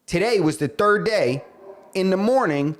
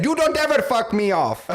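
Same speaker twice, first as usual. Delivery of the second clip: higher-pitched mock outrage; imitated offended caller; 6 semitones higher than usual. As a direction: act out an indignant caller, hammed-up. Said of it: "He said".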